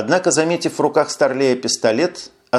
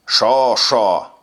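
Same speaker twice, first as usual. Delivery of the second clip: mildly irritated mimicry; snappish. A narrator's reading. Lieutenant mocks the sergeant's question, mildly snappish. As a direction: snap back with understated irritation. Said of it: "огрызнулся Старлей".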